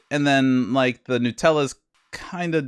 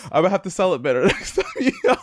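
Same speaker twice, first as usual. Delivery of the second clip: laughing; 4 semitones higher, faster than usual. Real, not acted. Laughing after failed bit.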